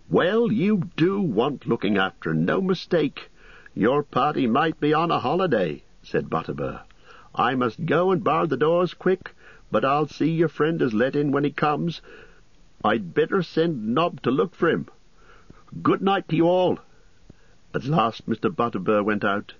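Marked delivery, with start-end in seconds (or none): 0.1-5.8 high, scolding landlord voice; animated, pitched-up landlord; chiding innkeeper. A narrator's reading. 7.3-16.8 high, busy landlord voice; animated, pitched-up landlord; bustling innkeeper. A narrator's reading.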